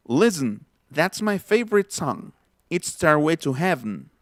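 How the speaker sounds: 'Listen' as LIS-n, 'favorite' as FAV-rit, 'Heaven' as HEV-n; natural English reductions. Shown as a demonstration. Teacher demonstrating the phrase, 'Listen', 'favorite', 'Heaven' all reduced. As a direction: swallow weak vowels in 'favorite' and 'Heaven', no t in 'Listen'. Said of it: "Otra vez".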